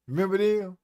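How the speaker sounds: ordinary talk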